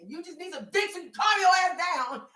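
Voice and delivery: high, full-volume mock rant; imitated angry yelling. Mimicking a mad guy before he hung up, yelling. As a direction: yell it like an angry man mimicked. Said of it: "he started yelling at the end".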